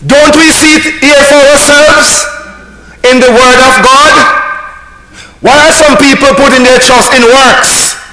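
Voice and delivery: preaching at full force. Real, not acted.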